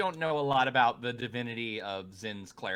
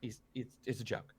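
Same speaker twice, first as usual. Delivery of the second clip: much softer, soothing aside; hushed reassurance; 9 dB quieter than usual. Acted. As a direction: soothe her in a near hush. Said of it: "Amantha goes".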